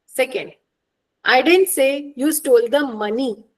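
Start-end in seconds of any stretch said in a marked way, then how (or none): 1.2-3.4 MONEY leaned on at the end; "money" stressed as the thing in question. Shown as a demonstration. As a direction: save the weight for "money". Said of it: "which means you stole something else".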